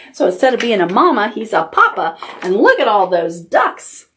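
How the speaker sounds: usual speaking voice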